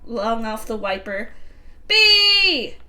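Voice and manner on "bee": shout. Acted. Character shouting a name.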